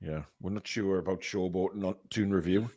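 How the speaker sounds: ordinary talk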